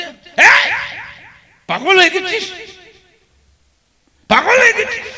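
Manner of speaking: high, sharp mother's scold. Acted. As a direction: scold him like an upset mother. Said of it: "তুই ধমক দিচ্ছে মা". (মায়ের ধমক, উঁচু গলায়)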